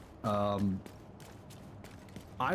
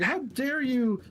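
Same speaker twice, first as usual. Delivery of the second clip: high, slow outraged yell; indignant shout; 13 semitones higher, 4 dB louder, slower than usual. Retold or imitated. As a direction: demand it like an offended regular. Irate customer scolding staff.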